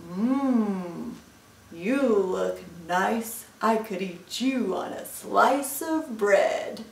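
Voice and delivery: slow, savoring monster; hungry Gruffalo. Acted. Gruffalo sizes up mouse as a meal, hungry. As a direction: relish each word like a tasty morsel.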